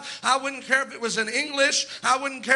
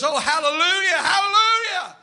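high, rising cry of praise, louder on the repeat; excited hallelujah; 6 semitones higher, 4 dB louder than usual. Retold or imitated. Preacher imitating Brother Trevor standing up in church, excited. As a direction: imitate an excited worshipper calling out. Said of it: "gets excited tonight and he stands up".

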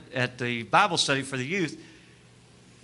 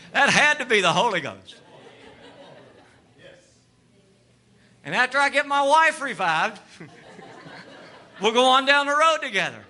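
raised, rousing pulpit voice; fervent preaching; 8 semitones higher than usual. Acted. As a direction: preach it hard to the congregation. Preaches at full force.

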